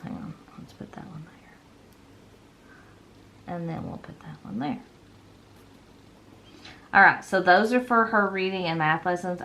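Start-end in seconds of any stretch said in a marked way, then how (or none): 0.0-4.8 whispered aside to self; whispering. Real, not acted. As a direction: breathe it under your breath. Placing stickers, talking to herself.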